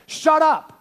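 loud, high snapping rebuke; the crowd silences the blind man, harshly; rude shut up. Acted. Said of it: "the people's response".

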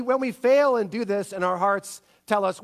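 ordinary talk

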